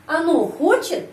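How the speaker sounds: хочет lifted high on its first syllable; questioning pitch peak on хочет; question intonation. Shown as a demonstration. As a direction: ask by raising хочет sharply. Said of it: "saya menyusun kalimat tanya".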